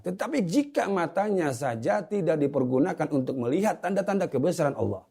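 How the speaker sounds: high-pitched, insistent sermon voice; forceful preaching. Real, not acted. Preacher telling viewers why eyes were given, forceful preaching. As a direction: press the argument home from on high.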